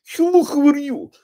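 loud, high, strained caricature of Russian; constipated mock-Russian accent. Shown as a demonstration. Coach mocking an inauthentic accent, constipated-sounding fake Russian. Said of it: "it sounds like you're constipated".

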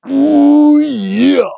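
high, loud growled ooh yeah; Macho Man impression. A fan throws in the wrestler's catchphrase, high and loud.

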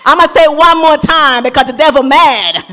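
fired-up preaching. Real, not acted. Preaching at the wedding.

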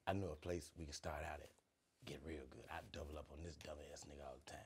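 whisper. Retold or imitated. Unc plotting, whispered.

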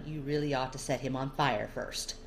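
ordinary delivery, nothing marked

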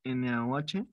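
ordinary talk

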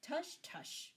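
high, gurgled dismissal from a smug abbot; gurgling. A narrator's reading. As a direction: brush it off in a high voice. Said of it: "gurgled the abbot".

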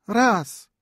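high little watch chime; tiny watch voice. A narrator's reading. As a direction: squeak it like a lady's watch. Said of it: "powtórzył zegarek jego małżonki".

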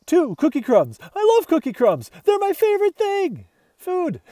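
greedy delighted squeal; excited dog. Acted. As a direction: build up to a joyful squeal. Dog raving about crumbs.

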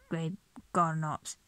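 usual speaking voice

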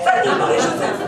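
loud pious outcry; loud exclamation. Acted. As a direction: blurt it out loud. Outburst tic.

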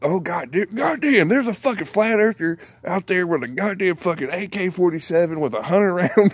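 over-the-top mock eyewitness, laughing; mock panicked witness. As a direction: yell it like a frantic onlooker, breaking into laughs. Mock news report, flat earther.

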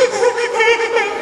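loud, high-pitched imitated hyperventilating. Speaker imitating himself hyperventilating after crying, exaggerated gasps. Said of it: "I'm like, hyperventilating".

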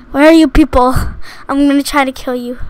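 ordinary talk, nothing marked

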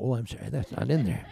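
usual speaking voice

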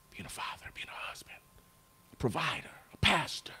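hushed whisper, unvoiced. Real, not acted. Preacher whispers his confession to the congregation. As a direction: lean in and breathe the words.

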